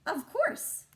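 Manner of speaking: high, loud, triumphant little squeak; proud cat. Acted. Lily as the cat answering Chester, proudly high. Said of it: "the cat replied".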